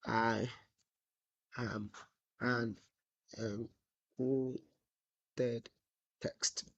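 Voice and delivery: low, soft, one word at a time; typing aloud. Real, not acted. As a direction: murmur it word by word while typing. Dictating to self while typing.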